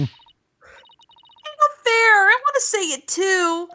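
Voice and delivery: whiny shout. Acted. Whiny kid protest in reading.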